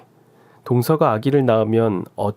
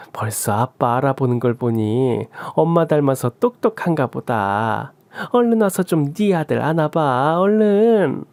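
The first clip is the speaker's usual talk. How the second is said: put-on gushing old woman, higher, crooning; doting mother-in-law; 5 semitones higher than usual. Mother-in-law gushing to her son about his newborn, higher and doting. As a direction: fawn over your son and the baby.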